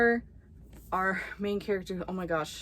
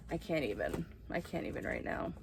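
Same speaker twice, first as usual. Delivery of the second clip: soft near-whisper, lost for words; overwhelmed hush; 2 semitones lower, 9 dB quieter than usual. Real, not acted. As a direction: murmur it like you're overcome. Overwhelmed by book edges.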